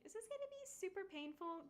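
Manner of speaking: high, worried mom voice; mimicking mom. Mom texting her worries, voiced high. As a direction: pitch up into her fretting mom. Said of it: "asking me, like".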